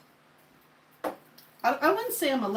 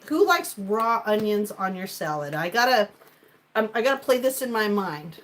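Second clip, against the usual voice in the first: usual speaking voice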